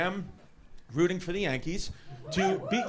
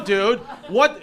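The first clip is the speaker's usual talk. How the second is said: usual speaking voice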